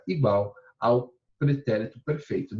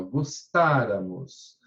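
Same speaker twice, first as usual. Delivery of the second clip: "gostáramos" slow, weight on "tá"; stressed syllable shown; 4 semitones lower, much slower than usual. Shown as a demonstration. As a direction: lean on the accented "tá" of "gostáramos". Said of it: "olha só o acento aqui".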